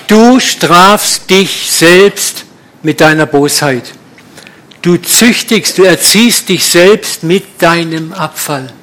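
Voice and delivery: slow, weighty reading of scripture; deliberate preacher's recitation; solemn scripture reading. Acted. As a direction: read the verse out as a solemn proclamation. Reads Bible verse solemnly.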